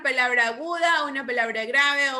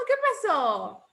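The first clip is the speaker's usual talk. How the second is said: ordinary talk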